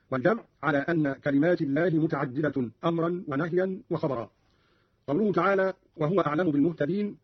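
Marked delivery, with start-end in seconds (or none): none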